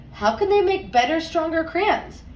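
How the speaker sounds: ordinary talk, nothing marked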